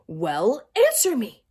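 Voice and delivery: loud, rising demand. A narrator's reading. Teacher demanding an answer from Todd. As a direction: insist he answer now. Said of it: "she demanded".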